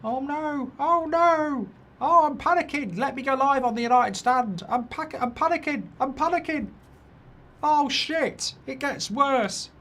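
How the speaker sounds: high squeaky fake panic; mock panic. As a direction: play a panicking rival fan, hammed up. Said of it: "that's about intimidating as a butterfly's fart, that bomber".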